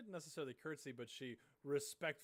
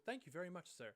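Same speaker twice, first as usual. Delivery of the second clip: higher grateful farm lady; lady's voice; 6 semitones higher than usual. Acted. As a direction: thank him sweetly in a lifted voice. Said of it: "she goes".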